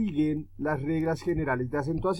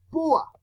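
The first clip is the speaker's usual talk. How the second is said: "púa" with a high-pitched, punched U; "púa", high emphatic U; stress on U; 12 semitones higher than usual. Shown as a demonstration. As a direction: jump up on the U of "púa", drop on the A. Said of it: "suena más duro la U".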